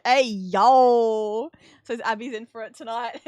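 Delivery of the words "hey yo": loud, drawn-out playful shout; big booming hey-yo; playful holler. Real, not acted. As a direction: holler it out in jest. Streamer jokingly hollers out.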